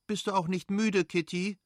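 calling out. Acted. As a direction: call to Kitty from the carriage. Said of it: "rief die Fürstin".

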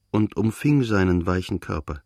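ordinary delivery

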